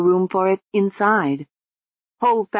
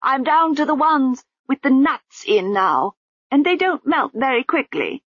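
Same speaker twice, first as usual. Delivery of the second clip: muffled, higher woman's voice; 7 semitones higher than usual. Acted. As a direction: speak through cloth over the mouth. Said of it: "said the muffled voice through the bandages".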